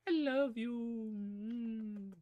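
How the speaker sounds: put-on high, slow squeaky baby talk; squeaky puppy voice. Owner imitating how she coos to her puppy. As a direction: coo it like to a puppy. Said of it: "how my voice gets so squeak".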